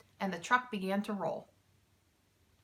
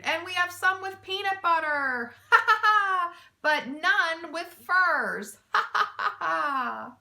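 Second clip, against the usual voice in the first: much higher and louder, howling laugh; ringing laughter; 8 semitones higher, 7 dB louder than usual. A narrator's reading. Mac mocks the thieves over furs, howling laughter. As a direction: laugh it right at them. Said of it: "With Mac's laughter ringing".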